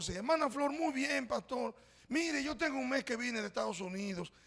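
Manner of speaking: pleased lady telling her news; chatty church lady. Retold or imitated. Lady answering the pastor. (señora contenta, voz algo aguda)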